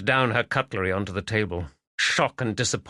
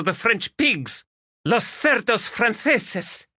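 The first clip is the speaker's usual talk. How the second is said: put-on high, accented and bitter, building in anger; angry Spanish lady; 11 semitones higher than usual. Magdalena raging to Stephen about her husband, high and accented.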